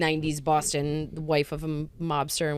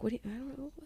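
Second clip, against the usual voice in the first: whisper; 7 semitones higher, 12 dB quieter, much faster than usual. Retold or imitated. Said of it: "I was like".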